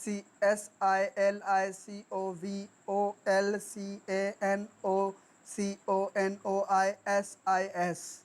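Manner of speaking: slow, evenly separated letters; spelling out. Shown as a demonstration. Teacher spelling the longest English word, slowly letter by letter. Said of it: "spelling".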